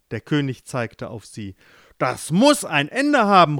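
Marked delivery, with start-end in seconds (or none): 2.0-3.6 louder, higher cry; calling out. Acted. King pointing at his daughter, calling out loud. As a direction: call it out firmly. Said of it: "rief er".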